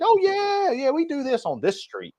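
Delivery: high, hammed-up Philly guy; mock Philly guy. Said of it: "it's like".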